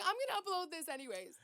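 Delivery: high, loud mock-defiant; defiant declaration. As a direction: announce it stubbornly, half joking. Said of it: "I was like".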